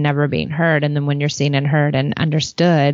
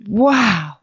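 slow, awed gush over a book; dreamy wow; 5 semitones higher, much slower than usual. Retold or imitated. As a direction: savour each word like a happy reader. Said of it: "I was like".

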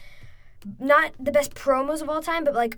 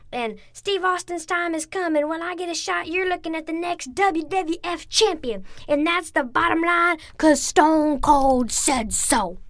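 much higher, slower snarling Stone Cold promo; imitating Stone Cold; 6 semitones higher, slower than usual. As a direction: taunt the old preacher, building to the punchline. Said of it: "he went on and he said".

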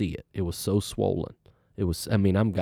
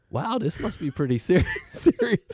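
higher, laughing through the word serious; nervous laughing realization; 14 semitones higher than usual. Retold or imitated. Patient alone in quarantine, nervous laughing realization. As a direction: laugh nervously as it dawns on you.

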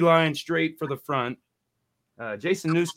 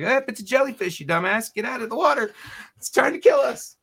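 mock panicked yell; 11 semitones higher than usual. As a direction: squeal it like a panicky friend yelling get out. Mocking buddy at the beach.

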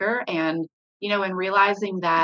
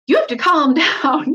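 frustrated yell; 7 semitones higher, 6 dB louder than usual. Shown as a demonstration. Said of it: "instead of".

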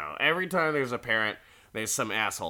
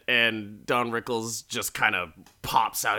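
ordinary delivery

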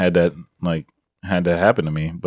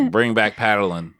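laughing; slower than usual. Real, not acted. Joking about paddling.

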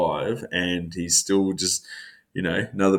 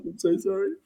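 higher, softer sheepish apology; mock apology; 11 semitones higher, 6 dB quieter than usual. Teammate apologising after snapping, higher softer mock. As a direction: shrink into a sorry. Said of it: "he's just like".

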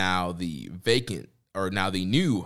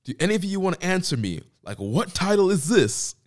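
higher and louder, hammed-up indignant rant; mock indignant yell; 10 semitones higher, 6 dB louder than usual. Host imitates Ospreay ranting at the press conference, indignant. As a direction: rant like a wronged champion. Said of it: "and he's like".

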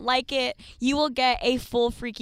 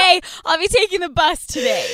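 mimicking friend; 5 semitones higher, 6 dB louder than usual. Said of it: "she's like".

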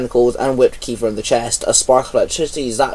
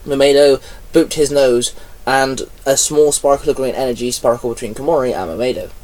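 plain reading voice

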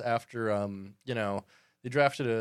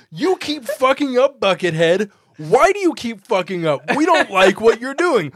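louder and higher, hammed-up scolding fan; mock angry fan yell; 8 semitones higher, 11 dB louder than usual. Hosts mocking a fan's complaint, hammed-up angry yell.

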